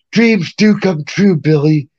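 lightly voiced rock star granting a wish. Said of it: "It is [name] voice".

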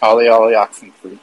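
flat robotic robot voice. Pretend. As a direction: say it like a choppy robot voice. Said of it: "I'm doing a robot voice".

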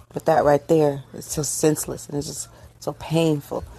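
ordinary talk, nothing marked

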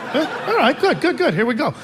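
a keen, hammed-up yes; eager high yes. Eager imagined reply.

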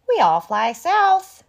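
high, loud goose call; geese voice. A narrator's reading. Narrator voicing the geese, high and loud. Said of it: "says the geese".

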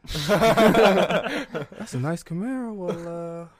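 high, laughing cop letting him go; amused cop. Retold or imitated. Cop admires the Camaro and lets granddad go, high and laughing.

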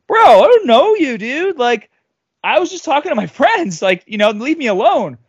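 high-pitched baffled denial; retold indignant comeback; defensive protest. Retold defensive reply to accuser.

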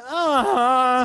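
loud high mock moan of pleasure. Friend acting out the character's moan, loud high mock moan. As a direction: ham up a sudden moan. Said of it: "instantly she's like".

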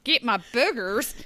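a hint of a hammed-up Australian accent; bad Australian. Joking about an Australian tester, mock Australian accent. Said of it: "He sounded very Australian".

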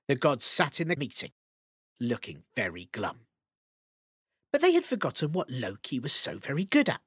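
ordinary delivery

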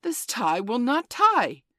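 high, loud, slow exasperation; real yell. A narrator's reading. Father raging at his tie, yelled. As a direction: yell each word out in fury. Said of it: "He really yelled".

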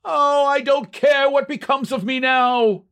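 high, hysterical outburst of a fan; hysterical cry. A narrator's reading. Long-winded fan after the game is tied, hysterical cry. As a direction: cry out high, beside yourself with joy. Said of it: "cried out hysterically".

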